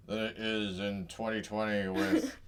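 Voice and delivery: halting put-on senator voice; mock an old turtle-like politician making excuses; Mitch McConnell. Host parodying the senator on blocking Merrick Garland, halting impression. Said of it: "the Mitch McConnell".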